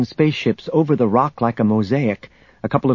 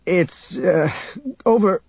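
faltering answer; 6 semitones higher, slower than usual. A narrator's reading. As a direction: stumble word by word, as if compelled. Han compelled to answer, faltering.

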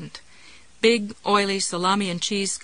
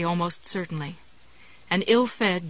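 ordinary delivery, nothing marked

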